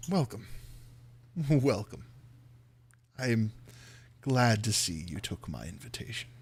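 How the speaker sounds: lightly voiced, unhurried count's welcome; measured, quiet formal host; Strahd greeting guests. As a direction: welcome them softly, pausing between phrases.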